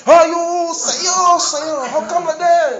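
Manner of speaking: high, dismayed wail; mock alarm. Preacher acting a patient shocked by results. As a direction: gasp at bad news.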